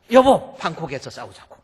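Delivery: loud yelled call, then drops; hammed-up angry husband; mock yell at spouse. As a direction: shout 여보 then grumble. Spouse yelling quarrel.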